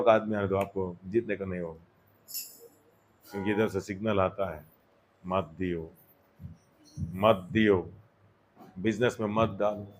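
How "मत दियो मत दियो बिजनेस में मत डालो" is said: low, hushed voice of a signal; quiet inner warning. Retold or imitated. Inner signal warning a believer about money, quiet acted warning. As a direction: murmur it as a private prompting, much lower.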